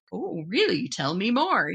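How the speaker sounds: swooping, curious mock reaction; intrigued. Host imitating people who hear the podcast's name, intrigued. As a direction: play it up as very intrigued.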